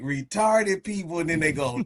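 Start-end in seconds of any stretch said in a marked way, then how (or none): none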